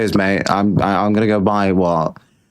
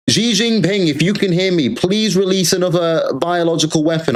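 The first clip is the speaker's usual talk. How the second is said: raised, mock begging plea; mock pleading; 6 semitones higher than usual. Streamer pleads with Xi Jinping for another virus, mock begging. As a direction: beg like a desperate supplicant, hammed up.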